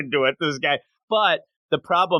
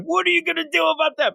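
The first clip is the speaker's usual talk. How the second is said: much higher and fast, a mock-defiant little girl; defiant taunt; faster than usual. Girl daring the adults after her father's exit, high and fast mock defiance. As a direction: taunt them like a cheeky kid daring a grown-up. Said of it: "She's like".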